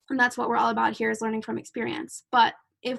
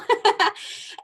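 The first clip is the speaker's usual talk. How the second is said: breathy laugh; 10 semitones higher, 4 dB louder than usual. Real, not acted. Laughs at her own story.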